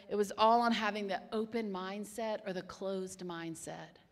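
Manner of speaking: ordinary delivery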